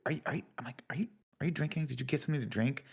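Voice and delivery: soft, whispered question; hushed whisper. Retold or imitated. Asking a friend at the table quietly, in a whisper. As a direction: hush it so the table won't hear.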